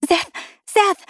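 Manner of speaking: very high, urgent name-calling; excited exclamation. A narrator's reading. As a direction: call out to him, bouncing with excitement. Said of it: "she exclaimed, bouncing in her seat".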